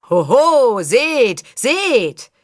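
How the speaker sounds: loud, high announcer call; booming fairground barker; declaiming showman. A narrator's reading. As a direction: proclaim it to the whole crowd. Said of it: "deklamierte ihr Vater".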